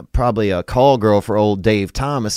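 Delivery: ordinary delivery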